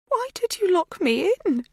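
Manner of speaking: very high, hurt whine; plaintive high-pitched complaint. A narrator's reading. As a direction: ask it as if wronged and baffled. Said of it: "Her voice is plaintive".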